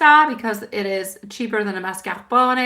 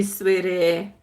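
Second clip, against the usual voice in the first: ordinary delivery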